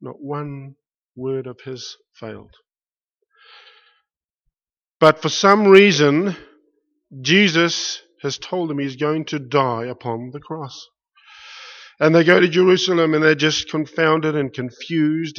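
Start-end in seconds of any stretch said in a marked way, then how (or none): 5.0-15.4 loud, slowed, driving sermon build; preaching at full force. Acted. Preacher pressing the crowd on the disciples' confusion, loud preaching. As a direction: preach it out to the whole hall.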